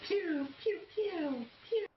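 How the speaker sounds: high playful pew pew gun sounds; mock gunshots. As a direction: fire off toy gun noises. Imitating pistol shots.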